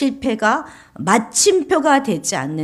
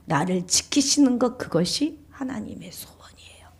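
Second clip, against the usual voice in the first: ordinary delivery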